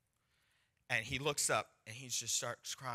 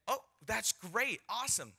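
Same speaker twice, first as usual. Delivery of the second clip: mock scripted enthusiasm; 7 semitones higher than usual. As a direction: act out a rehearsed delighted reaction. Acting out the evangelist's scripted reaction.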